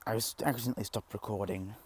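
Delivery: ordinary talk, nothing marked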